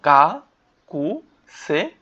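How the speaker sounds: গাকুসেই stretched very long, every syllable held; drawn-out gakusei. Shown as a demonstration. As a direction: draw out গাকুসেই slowly, syllable by syllable. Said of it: "উচ্চারণটা কিন্তু গাকুসেই হয়".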